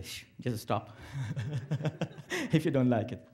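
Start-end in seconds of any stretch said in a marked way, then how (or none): 0.0-0.9 a soft playful shush; mock hush. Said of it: "raise your hand and say". 1.1-2.5 short quiet laugh at his own joke; soft chuckle. Real, not acted.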